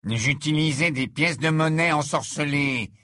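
put-on tense, defiant young wizard; a scared boy trying to sound tough; nervous teen voice. Malfoy voiced in audiobook.

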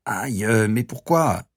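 doubled over, outraged ouch; doctor kicked in the shin, pained yelp; groaning in pain. A narrator's reading. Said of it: "se plia en deux en gémissant".

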